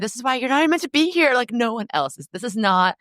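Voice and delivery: a retold snap at herself, played light; mock self-scolding. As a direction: scold yourself in a rush, half-joking. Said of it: "I really, like, got mad".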